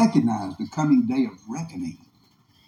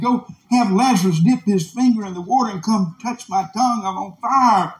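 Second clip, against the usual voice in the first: raised, urgent plea; crying out; 6 semitones higher than usual. Acted. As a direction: act out a desperate begging cry. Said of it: "He cried out".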